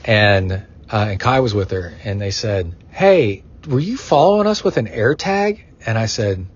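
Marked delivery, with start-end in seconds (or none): 2.9-5.6 higher-pitched, incredulous question; mock-suspicious asking; surprised question. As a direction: retell their puzzled accusation, pitched up. Retells friends' surprised question.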